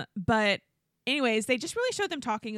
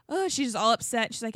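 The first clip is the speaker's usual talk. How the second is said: normal talking voice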